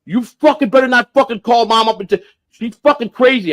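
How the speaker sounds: high-pitched imitation of an angry brother; retold pissed-off brother. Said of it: "my brother was fucking pissed at me".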